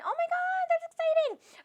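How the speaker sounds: very high-pitched, delighted squeal; thrilled, sky-high voice; excited squeal. Real, not acted. Reacts excitedly to a viewer's news.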